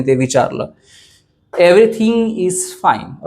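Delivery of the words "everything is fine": slow, each word clear; slow dictation. Shown as a demonstration. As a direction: say it slowly first for learners. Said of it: "कसं म्हणणार". (हळू, स्पष्ट उच्चार)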